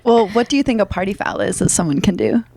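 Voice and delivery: ordinary talk, nothing marked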